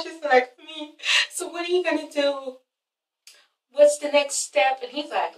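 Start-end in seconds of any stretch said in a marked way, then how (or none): 0.6-4.8 imitating tearful quiet questioning. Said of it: "I don't wanna see you cry".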